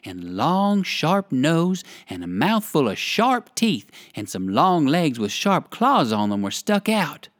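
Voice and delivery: ordinary delivery, nothing marked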